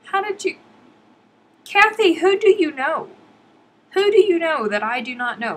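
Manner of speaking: high, loud mock indignation; mock-jealous demand. Real, not acted. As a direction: demand it in playful outrage. Playful jealous demand to Kathy.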